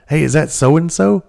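high-pitched question, rising at the end; imitating a surprised phone query; retold curious question. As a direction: ask it up high, as if checking who's there. Asking about voice heard in background.

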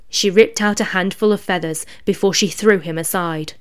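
ordinary delivery

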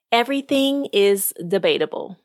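ordinary delivery

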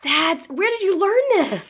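high-pitched, delighted disbelief; astonished. Real, not acted. Co-host asking where the trick came from, astonished. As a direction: demand it in happy amazement.

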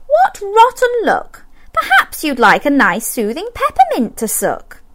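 put-on a kind girl offering help; girl's voice. A narrator's reading. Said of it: "She said".

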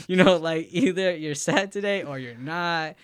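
laughing loud and high while ranting. Real, not acted. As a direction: laugh through the words, amused. Laughing mid-rant about friend.

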